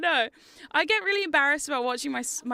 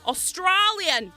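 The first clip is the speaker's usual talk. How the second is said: slow, higher-pitched mock Australian accent; exaggerated Australian accent, stretched slowly; best Australian accent; 4 semitones higher, 3 dB louder, much slower than usual. Shown as a demonstration. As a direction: draw it out in a hammed-up Aussie accent. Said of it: "that's my best Australian accent".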